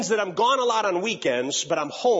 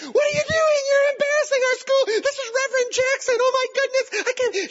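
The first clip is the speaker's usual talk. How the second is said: high, loud, shrill scolding; ranting vice principal; 5 dB louder than usual. Retold or imitated. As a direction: scold the whole class in a high, loud rant. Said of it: "she went on a rant".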